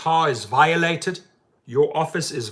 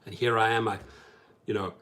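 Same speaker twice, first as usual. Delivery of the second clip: usual speaking voice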